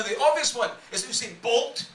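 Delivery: usual speaking voice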